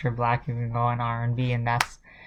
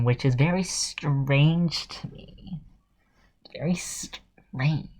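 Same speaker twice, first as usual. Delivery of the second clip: sarcastic; 5 dB quieter, slower than usual. Retold or imitated.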